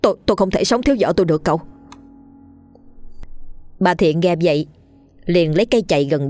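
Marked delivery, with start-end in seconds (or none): 0.0-1.6 desperate pleading. Acted. Husband begs villain.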